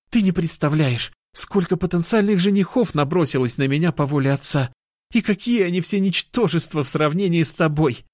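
put-on high, excited girl's voice; gushing cat girl. Girl gushing to her returned lover, high and eager. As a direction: pour it out in a high girlish voice.

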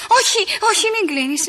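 high, alarmed plea; urgent plea. Acted. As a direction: beg the caller to stay on the line. Παρακαλεί να μην κλείσει το τηλέφωνο. (επείγουσα, ανήσυχη ικεσία)

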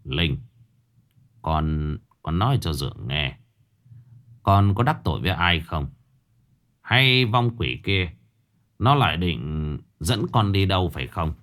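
stepfather gently asking his daughter about the ghost; grave stepfather. Acted. Stepfather Hải speaks.

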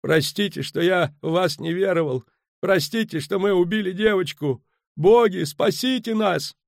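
high, pleading wail; moaning prayer. A narrator's reading. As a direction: beg the gods in a lamenting high voice. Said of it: "стонал Кевинард ван Влит".

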